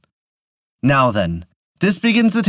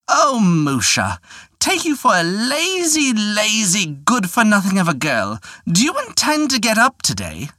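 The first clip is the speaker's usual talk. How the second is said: scolding housewife; 9 semitones higher than usual. Acted. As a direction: nag her daughter up out of bed, pitched high. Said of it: "cry out to a lazy darter".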